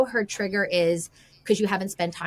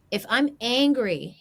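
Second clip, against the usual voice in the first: usual speaking voice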